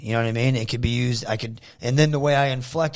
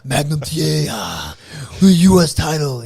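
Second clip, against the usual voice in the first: a hint of the Russian heel, the title line punched louder; light Koloff promo voice; Nikita Koloff impression; 5 dB louder than usual. Fan cutting a Nikita promo on wrestlers walking to their cars, lightly voiced. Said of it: "That's the best impression I've heard yet".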